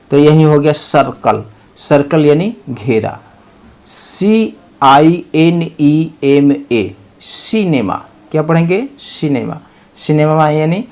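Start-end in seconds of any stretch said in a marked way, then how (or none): 3.9-6.9 letters drawn out one by one, very slow; drawn-out letter spelling. Shown as a demonstration. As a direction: spell it slowly for the class. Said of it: "spelling out letters".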